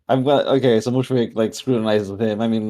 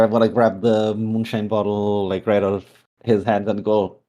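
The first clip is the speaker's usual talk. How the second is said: ordinary talk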